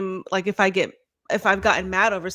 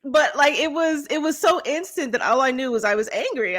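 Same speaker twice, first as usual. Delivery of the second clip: ordinary delivery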